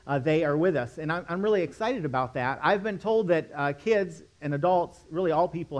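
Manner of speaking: ordinary talk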